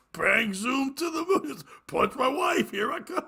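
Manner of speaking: high-pitched comic threat; hammed-up sitcom husband bluster; Ralph Cramden. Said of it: "And he's like".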